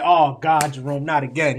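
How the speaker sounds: loud, dramatic oh-no; retelling his reaction to the coworker, a loud mock exasperation; exasperated groan. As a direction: throw up your hands, loudly.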